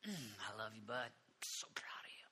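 soft, loving hush; Jesus whispering love to a faithful friend; tender whisper. Retold or imitated. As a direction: whisper it with deep affection. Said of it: "the affection in Jesus' eyes".

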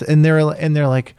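ordinary delivery

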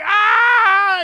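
loud, high scream of pain, hammed-up; re-enacted scream. Husband fallen on the front porch, re-enacted high scream. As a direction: replay your own scream, high and loud. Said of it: "And I'm screaming".

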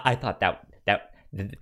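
ordinary delivery

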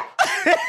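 very high mock shriek; one abrupt, piercing kid's yelp; imitated short scream. Showing how the boy screamed for a second, abrupt high shriek. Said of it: "literally just screamed for a second".

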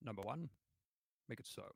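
hushed, lightly voiced captain impression; Picard. Shown as a demonstration. Taking a turn for balance, quiet captain impression. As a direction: give a soft captain's order. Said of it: "That was a good Picard".

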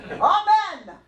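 high, projected African-voice call; put-on African voice for echoing. Shown as a demonstration. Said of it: "say it with your African voice".

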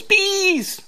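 high, loud in-character shout; gleeful yell. Acted. Seller throws open the box at buyer, high yell. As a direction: yell it high as you spring the trap. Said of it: "throws open the hatch and yells".

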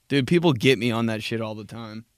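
ordinary delivery

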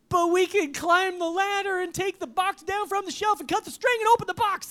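high, loud storybook objection; high-pitched, frantic-sounding read-aloud character; escalating protest. A narrator's reading. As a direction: pile up the loopholes insistently.

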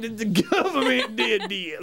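drawn-out, laughing mock accusation; slow, silly conspiracy-nut voice; mock conspiracy theorist. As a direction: play a conspiracy nut, dragging the words.

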